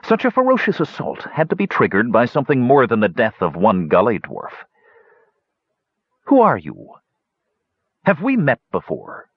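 high, curious villain voice; sinister hunchback. A narrator's reading. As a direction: toy with the prisoner in a high voice. Pitrick voiced by narrator.

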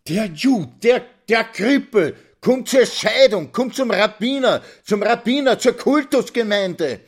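very high, loud, shrieking wife; screaming through streets. A narrator's reading. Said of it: "schrie sie durch die Gassen". (gellend durch die Gassen schreiend)

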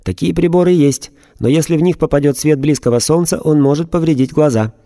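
a robot calmly warning; emotionless robot. A narrator's reading. Robot reports.

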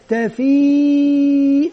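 put-on تا، في stretched very slowly like a child sounding out; child sounding out a word; child spelling. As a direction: drag تا، في out as a small pupil would. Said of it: "جاء طفل وقال".

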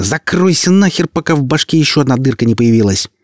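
put-on girl threatening a rambling servant; furious shout. A narrator's reading. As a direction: shut him up hard. Olya silences Nark.

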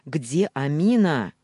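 loud, slow yell. A narrator's reading. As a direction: yell the name out. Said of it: "заорал".

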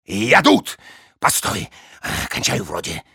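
grunting, straining, fading rattly voice; rattly strained voice. Acted. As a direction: croak it out while straining. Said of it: "кряхтение и дребезжащий голос".

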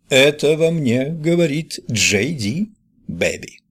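lightly done cool rebel drawl; James Dean impression. A narrator's reading. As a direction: play it as a cool movie rebel. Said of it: "как Джеймс Дин".

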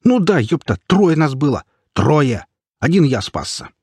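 high, agitated testimony; worked-up survivor pleading his case; insistent marauder. A narrator's reading. Marauder keeps up his accusation.

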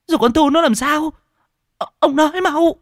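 very high, loud, frantic questioning; panicked demand. Acted. Wife demanding news of her daughter, frantic and shrill. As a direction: shriek for an answer in panic.

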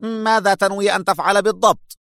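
tense demanding question; sharp. A narrator's reading. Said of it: "يقول في حدة".

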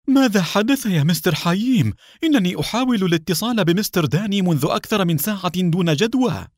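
high, fast, anxious questions; eager. Acted. Hussein anxiously asks Haim about Danny. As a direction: rush the words out, pitch way up. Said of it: "فسأله حسين بلهفة".

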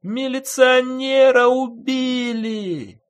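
very high, long-drawn wail; loud, stretched shriek of a woman; wailing scream. A narrator's reading. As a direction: cry the alarm out of a window. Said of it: "заголосила, высунувшись в окно, женщина".

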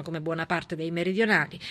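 ordinary talk

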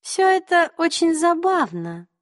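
high, slow and icy; cold queen. A narrator's reading. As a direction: say it coolly, unamused. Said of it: "холодно произнесла королева".

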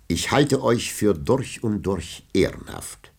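dry irony. A narrator's reading. Villon mocks the knight.

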